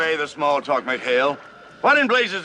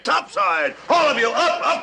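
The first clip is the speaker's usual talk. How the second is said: barked order; 9 semitones higher than usual. Acted. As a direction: bark the order at the whole crew. Admiral orders everyone topside.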